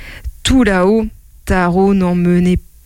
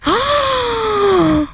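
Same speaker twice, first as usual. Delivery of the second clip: long, high-pitched "ah"; startled cry. Acted. Taro rushing to recover his jacket, high startled cry. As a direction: let out a drawn-out high cry. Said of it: "fit Taro en se précipitant".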